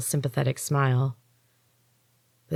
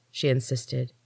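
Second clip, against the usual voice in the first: ordinary delivery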